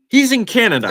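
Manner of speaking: heated. Real, not acted. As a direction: let the outrage push the pitch up. Heated rant about the stage rush.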